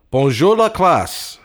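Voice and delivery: high, loud, slow teacher's greeting; sing-song classroom hello; old French teacher. Acted. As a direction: boom it out like a proud old professor.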